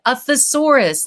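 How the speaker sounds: mild mock yell of "A thesaurus" to a child in another room; yelling back. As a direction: call "A thesaurus" across the house. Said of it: "And I yelled back".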